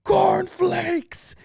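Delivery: very high, strained goofy holler; imitated scream. Retelling his own dumb shout at the concert, high mock scream. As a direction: yell it high like a heckler in a crowd. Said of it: "my dumb ass goes".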